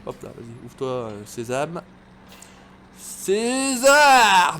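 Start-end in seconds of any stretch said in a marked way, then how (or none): 3.0-4.6 loud, high drawn-out shout; calling out. Pretend. Player commanding a door to open, loud drawn-out call. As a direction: bellow it like a magic command.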